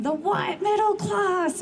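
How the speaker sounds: half-hearted impression of a fiery activist denouncing; condemning quote. Speaker at rally quoting Sylvia Riviera's condemnation, slow and high. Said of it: "condemned everyone, going".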